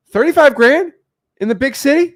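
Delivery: can't believe what you just heard; incredulous cry at a tiny salary; shocked disbelief. Retold or imitated. Shocked at low Italian salary.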